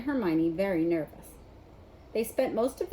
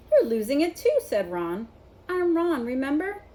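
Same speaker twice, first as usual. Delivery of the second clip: teasing; 6 semitones higher, 6 dB louder than usual. A narrator's reading. Ron teasing Harry.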